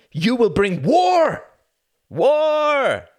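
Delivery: slow, loud, high toast call; rowdy cheer. A narrator's reading. Drinkers toasting war together, loud cheer. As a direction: raise your glass and cheer. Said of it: "they all called out".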